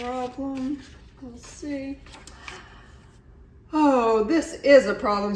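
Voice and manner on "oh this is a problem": louder worried alarm; worried exclamation. A narrator's reading. Walrus worried about Iguana flying, loud alarm. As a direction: raise the alarm. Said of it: "said Walrus".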